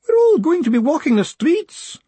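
wallow in the despair. Acted. Despairing clerk laments.

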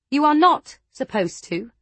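loud, firm and cutting; angry retort. A narrator's reading. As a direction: throw it back at her. Lada angrily retorts.

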